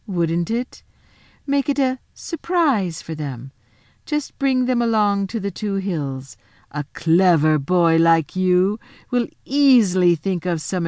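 slow, sweetly scheming queen voice; the Queen, sly and flattering. Acted. The Witch Queen persuading Edmund to keep her secret, slow and sly. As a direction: flatter him like a velvet trap.

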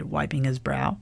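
plain reading voice

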